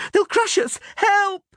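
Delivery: extremely high, terrified yelp; Dandelion's shout. A narrator's reading. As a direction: scream for help in panic. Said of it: "Dandelion shouted from the ground".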